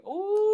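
high teasing "ooh"; gossipy rising "ooh" at trouble brewing; drawn-out ooh. Real, not acted. Podcaster reacts to jealous woman watching the hug, teasing ooh.

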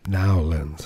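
"New Orleans" squashed into "Nawlins"; local pronunciation. Shown as a demonstration. Showing how locals name the city, "New Orleans" as "Nawlins". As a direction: say "New Orleans" as two syllables, "Naw-lins".

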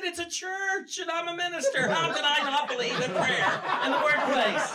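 high, loud, laughing disbelief; exasperated squeal of disbelief at the absurdity; incredulous shout. Retold or imitated. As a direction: throw up your hands and yell it, half laughing. Retelling his exasperated reply to congregant.

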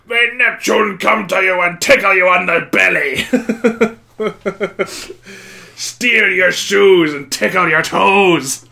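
big booming voice, laughing through it; loud, slow, mock-threatening sea captain; booming Willem Dafoe. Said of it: "that big booming voice".

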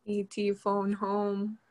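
slow, drawn-out E T line; slow quote. Retold or imitated. Asked for the famous line, slow alien quote. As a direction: drag each word like the alien. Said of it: "What is his famous line".

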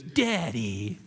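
drawn-out mock call with a swooping fall; put-on grown son needling his father; estranged son's taunt. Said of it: "And then he goes".

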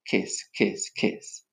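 soft, voiceless little kisses; whispered kisses. A narrator's reading. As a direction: whisper each kiss separately. Reads out love graffiti kisses.

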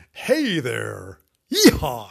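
hammed-up American cowboy holler; American cowboy accent. Podcaster showing off for a Western episode, American cowboy accent. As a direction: drawl it, then whoop loudly in American. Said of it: "I could do the American accent".

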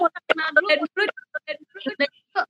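choppy broken audio; glitchy jumping pitch. Real, not acted. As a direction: voice cutting in and out on a bad call. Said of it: "aduh maaf nggak jelas, suaranya putus-putus".

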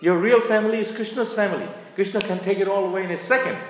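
fervent, high-pitched rhetorical outburst; impassioned preaching. Real, not acted. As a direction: pitch it high and drive the point home. Preacher challenges the congregation.

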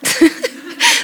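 short high breathy laugh. Real, not acted. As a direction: let a real laugh escape, high. Laughs after request.

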